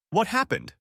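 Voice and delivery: high, anxious demand; panicked question. Acted. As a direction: rush it out in worry. Panicked groom at Anne's door.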